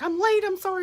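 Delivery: high squeaky mock apology; hammed-up high apology; imitating flustered apology. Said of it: "Everybody's like".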